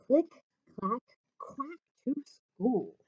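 storybook title; title read. Acted. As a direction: announce the book title. Reads the book title.

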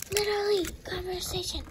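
fast unvoiced whisper. Toy muttering an aside after the interruption, whispering. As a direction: hiss it under your breath.

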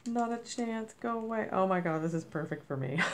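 ordinary talk

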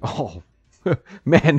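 ordinary delivery

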